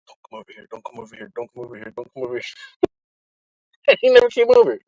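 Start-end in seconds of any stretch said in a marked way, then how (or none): 0.1-2.4 very soft repeated pleading mutter; hushed, begging him silently to stay away; pleading under breath. Retold or imitated. Watching the guy who would walk him out, hushed pleading.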